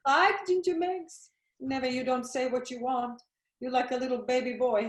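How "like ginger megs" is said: higher, louder and slow, scornfully repeating her words; mocking echo. Mother scornfully repeating her daughter's slang, mocking. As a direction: throw the phrase back with disbelief.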